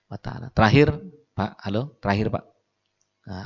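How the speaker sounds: usual speaking voice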